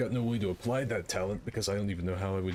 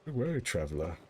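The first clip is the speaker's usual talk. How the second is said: character voice. Shown as a demonstration. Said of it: "with my Peyton".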